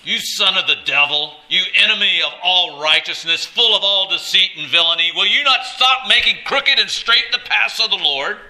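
loud, high thundering denunciation; forceful rebuke. Acted. Paul confronting Elymas the magician, forceful shouted rebuke. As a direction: thunder it out as a fierce Scripture rebuke. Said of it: "He said it with some force".

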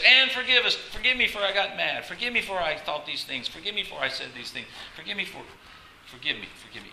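high, loud, repeated crying out for forgiveness; pleading prayer. Acted. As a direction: cry out over and over like a desperate prayer. Preacher voices pleading prayer.

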